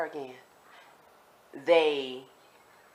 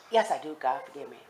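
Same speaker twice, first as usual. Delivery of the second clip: ordinary talk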